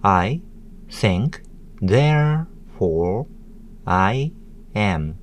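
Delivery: "I think, therefore I am" split into separate syllables with pauses; one spaced syllable at a time on "I think, therefore I am". Shown as a demonstration. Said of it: "six syllables".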